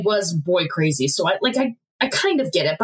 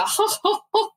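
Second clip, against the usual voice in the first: high, choppy imitated laughter; mock laugh; 11 semitones higher than usual. Describing Joseph's shaking shoulders, a mock laugh. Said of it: "his shoulders are, like, going up and down".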